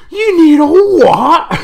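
high, slow, loud Scottish disbelief; mock Scottish accent. Kernish players, stunned Scottish-accented reply. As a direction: drag it out in a Scottish brogue. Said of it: "with Scottish accents goes".

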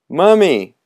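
high loud cry; cried out. Acted. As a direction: shout your costume name. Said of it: "cried the boy".

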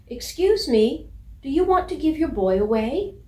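high, loud polite calling out. Acted. Puppy calling politely to a setter across the yard, loud and high. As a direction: call across politely at full voice. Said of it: "called out politely".

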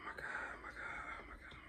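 breathless whisper, barely voiced; whispered oh my God. Real, not acted. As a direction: whisper it to yourself in disbelief. Overwhelmed by the other models.

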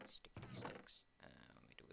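voiceless, playful whispered echo. Pretend. Player repeating the item's name to themself, whispering. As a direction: whisper the words back to yourself.

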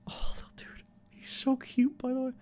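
breathy whisper over the dozing puppy. Real, not acted. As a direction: whisper so the dog stays calm.

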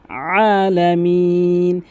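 আলামিন said slowly with a long open আ; slow correct recitation. Shown as a demonstration. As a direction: draw out the আ of আলামিন. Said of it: "আ বলতে হবে".

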